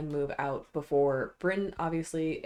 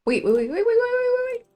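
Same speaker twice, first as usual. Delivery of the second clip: excited; 11 dB louder, faster than usual. Real, not acted. Urgent check on the game.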